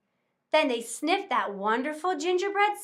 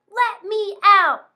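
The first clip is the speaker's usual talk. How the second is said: slow, loud cry from inside the oven; 6 semitones higher, 8 dB louder, slower than usual. Acted. Trapped cookie cries loudly from the oven. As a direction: gingerbread man calling to be let out. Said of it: "cried an odd little voice".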